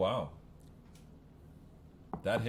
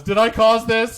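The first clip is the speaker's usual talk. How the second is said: panicked inner thought; 13 semitones higher, 19 dB louder, slower than usual. Acted. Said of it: "his last thought was".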